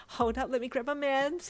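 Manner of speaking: high excited squeal; squealing excitedly. A narrator's reading. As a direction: squeal it out in a rush. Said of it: "he squealed".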